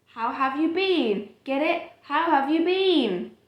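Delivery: put-on milk the pun for the kid; hammed-up joke. Parent reading the bean joke aloud, hammed-up and slow.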